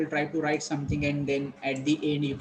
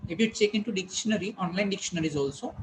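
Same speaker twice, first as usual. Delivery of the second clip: usual speaking voice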